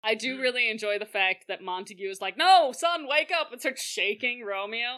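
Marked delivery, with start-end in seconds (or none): 2.3-3.5 loud, high mock panic; frantic plea. Montague shaking his son Romeo, frantic. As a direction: cry out as a frantic father.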